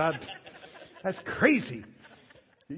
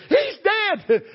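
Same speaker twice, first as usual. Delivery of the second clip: high panicked mother's wail; frantic mother; 11 dB louder than usual. Retold or imitated. Mother in the bull ring over her son, high panicked wail. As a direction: cry out like a terrified mama. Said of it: "She's down in the dirt with me going".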